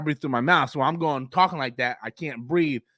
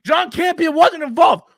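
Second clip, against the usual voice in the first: mock commenter; 12 semitones higher, 9 dB louder than usual. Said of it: "I have people going".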